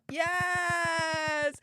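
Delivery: a sudden, delighted cry of yes; excited cheer. Real, not acted. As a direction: cheer it like the favourite sticker just landed. Catchphrase cheer for sticker.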